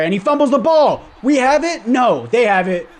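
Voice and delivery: high, loud burst of surprise; excited. Real, not acted. Gamer reacting live to a fumble, excited. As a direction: react fast to the fumble, thrilled then deflated.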